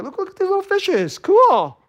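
high, wide-eyed delight at the fish; carefree joy. Acted. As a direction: marvel like a kid at an aquarium. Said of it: "in joy".

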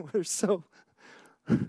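laughing while talking; a real laugh breaking in; rueful laughter. Real, not acted. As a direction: laugh so you won't cry. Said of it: "I'm laughing".

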